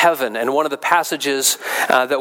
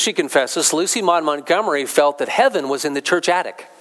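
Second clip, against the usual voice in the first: normal talking voice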